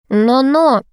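a touch of indignation; mock indignant. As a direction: warn a lover off, half-seriously. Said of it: "возмутилась Зуфарова".

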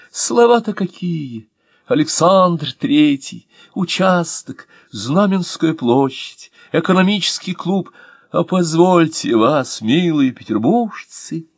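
high, slow, deeply stirred; moved. Acted. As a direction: savour each name with emotion. Actor moved by memories. (растроганно, высоко и медленно)

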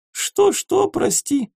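high, teasing feigned confusion; mock deafness. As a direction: pretend not to hear, mocking.

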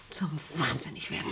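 whispered, very soft; hushed obsession. Acted. Boss whispers her obsession with power to the wife. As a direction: let it sink to a breath.

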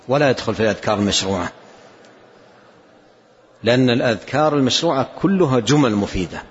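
ordinary talk, nothing marked

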